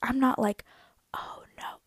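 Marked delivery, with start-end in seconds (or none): 1.1-1.8 tiny whispered dismay; barely audible mock-polite whisper. Shown as a demonstration. Golfer shows how they didn't swear, in a whisper. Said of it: "I didn't whisper them".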